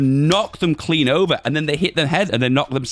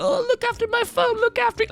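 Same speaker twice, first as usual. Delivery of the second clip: very high, fussy mock of timid phone owners; mocking whiny voice. Vlogger mocking his timid viewers in a whiny voice. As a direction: squeak it like someone babying their phone. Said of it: "you guys, you're like".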